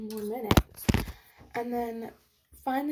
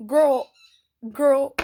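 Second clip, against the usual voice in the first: louder, exasperated calling out; 10 dB louder than usual. Real, not acted. Snapping back at the rude girl, loud. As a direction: call her out, loud and fed up. Retort in the quarrel.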